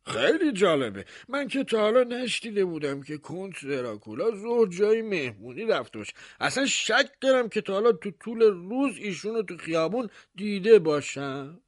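high, doubting mayor; mayor's voice. Acted. Mayor doubting the baker's tale, high and sceptical. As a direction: pitch it up with sceptical disbelief.

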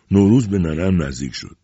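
usual speaking voice

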